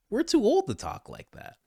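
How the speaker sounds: mock scolding. Real, not acted. Teasing Benny over his slang, high and sing-song. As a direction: playfully scold a younger colleague.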